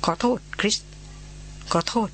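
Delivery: a touch of a quaver, mildly choked; trembling voice. Acted. Wut apologising to Christina, voice slightly trembling. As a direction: apologise with a faintly breaking voice. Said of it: "พูดเสียงเครือ".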